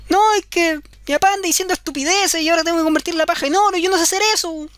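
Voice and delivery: put-on high, pleading girl voice; a girl begging the goblin for help; whiny young girl. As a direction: whine it like a helpless girl.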